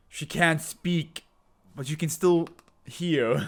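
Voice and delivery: slow, sneering taunt; mocking taunt. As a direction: rub it in, drawn out. Taunting enemy again.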